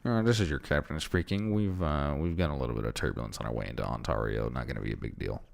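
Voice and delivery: low, fast captain announcement; pilot cockpit headset. Retold or imitated. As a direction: rattle it off like a bored pilot. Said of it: "It's gonna be a spot on pilot impression".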